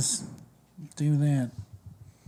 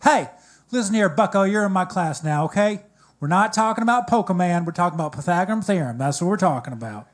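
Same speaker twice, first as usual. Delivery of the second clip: loud, high-pitched scolding Southern twang; exaggerated Southern accent, calling a class to order; southern math teacher; 5 semitones higher, 6 dB louder than usual. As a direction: lay down the law like a Southern schoolteacher. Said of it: "had the most southern accent".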